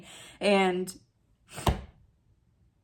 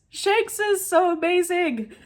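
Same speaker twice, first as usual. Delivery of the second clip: tearing up; 10 semitones higher than usual. Real, not acted. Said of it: "I'm, like, tearing up".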